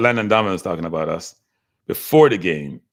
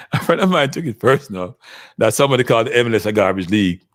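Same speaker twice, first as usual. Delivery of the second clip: ordinary talk, nothing marked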